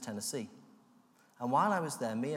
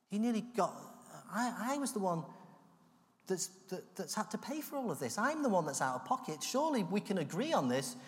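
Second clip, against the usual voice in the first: pleading protest; 6 semitones higher than usual. Retold or imitated. Pleading with God, arguing.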